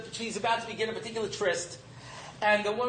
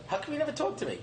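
higher-pitched retold plea to a daughter; mildly exasperated appeal; plaintive parent; 6 semitones higher, 4 dB quieter, much faster than usual. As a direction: ask it as a hurt, puzzled dad. Plaintive parent quoting himself.

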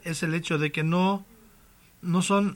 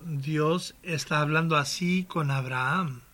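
normal talking voice

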